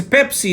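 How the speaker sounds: "Pepsi" with both Ps unvoiced; correct P in Pepsi. Shown as a demonstration. Trainer giving an example of correct pronunciation, clear P in "Pepsi". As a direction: say "Pepsi" with clear puffed P, not B. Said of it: "try to pronounce correctly".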